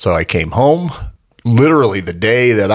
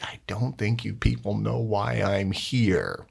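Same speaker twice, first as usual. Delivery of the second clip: exasperated mutter; 10 dB quieter, slower than usual. Retold or imitated. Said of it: "I'm like".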